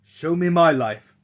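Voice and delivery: loud, high plea; eager demand. Acted. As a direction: call out urgently to Death. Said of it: "said the doctor".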